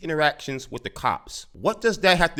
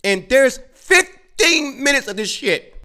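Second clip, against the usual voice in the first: exasperated; 10 semitones higher, 6 dB louder, slower than usual. Real, not acted. Exasperated rant at clip.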